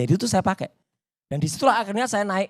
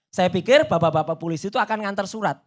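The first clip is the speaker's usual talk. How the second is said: normal talking voice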